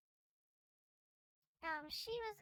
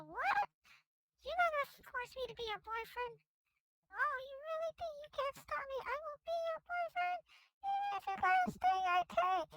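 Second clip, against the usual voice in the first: put-on much higher, insistent girl voice; high shrill girl voice; 10 semitones higher, 5 dB louder than usual. Lovesick girl to boy, demanding in a very high voice. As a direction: shriek it like a spoiled girl.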